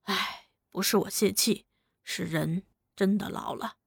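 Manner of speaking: 老人叹气，轻声无奈地说; weary sigh. A narrator's reading. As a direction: let the breath out as you admit you are old. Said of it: "天雄叹了口气说".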